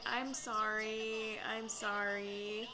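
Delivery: slow, drawn-out mock-sorry voice; a hint of a fake-sorry Victoria; fake-sounding apology. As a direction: imitate an apology that sounds insincere. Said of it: "that voice where no matter what she says, it sounds fake".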